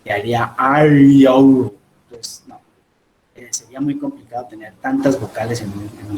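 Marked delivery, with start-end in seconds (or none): 0.6-1.8 "are you" run together into one awkward, loud blend; loud, clumsy slurred-together "are you"; forced contraction. Shown as a demonstration. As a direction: squash "are, you" into a single drawn-out glide of vowels. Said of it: "si quisiéramos abreviar esta".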